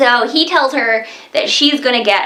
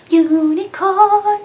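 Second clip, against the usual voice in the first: "Unicorn" stretched slowly, higher; dreamy, slow higher call of the word; drawn-out unicorn; 9 semitones higher, much slower than usual. Pretend.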